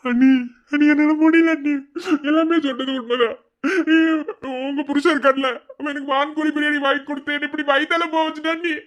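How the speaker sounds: high whining lament, swelling; hammed-up crying complaint; tearful pleading. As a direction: pour out your misery, wailing. Complains in agony to sister-in-law.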